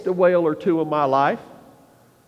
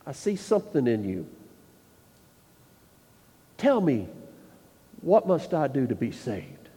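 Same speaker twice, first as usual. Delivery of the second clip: quiet earnest plea; 6 semitones lower, 6 dB quieter than usual. Pretend. Enemy asks how to be saved.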